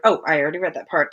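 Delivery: plain reading voice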